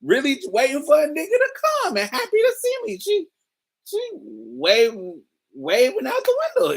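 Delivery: high, giddy mock of a stoned guy; lightly voiced excited stoner; mocking his high thoughts. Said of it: "my high brain was like".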